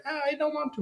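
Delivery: high mock whine; hammed-up high whining Louis; whiny Louis. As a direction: whimper the refusal. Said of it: "he's like".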